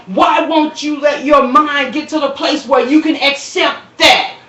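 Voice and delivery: a full-throated preacher's challenge; preaching at full force. Real, not acted. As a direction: plead with them at the top of your voice.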